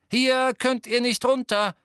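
loud, high, slow call to the crowd. Acted. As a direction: shout a warning to the crowd. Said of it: "rief Ginny der Menge zu".

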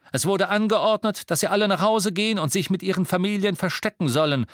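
loud, high-pitched proclamation; official announcement. A narrator's reading. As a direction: announce an order to a crowd. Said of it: "verkündete Harry".

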